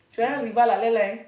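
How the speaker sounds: ordinary delivery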